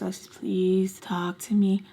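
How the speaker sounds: slow, hushed calm plea. Retold or imitated. Caller begging Alexis to talk to her, calm hushed voice. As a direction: plead slowly, almost whispering. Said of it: "in a calm voice".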